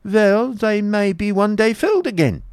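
very high, lilting Baron voice; optimistic Baron. Acted. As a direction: counter it hopefully, way up high. Baron argues back with genius.

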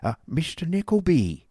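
high, pompous schoolmaster voice; Squeers the schoolmaster. A narrator's reading. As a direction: play Squeers as a bluff, self-satisfied pedagogue.

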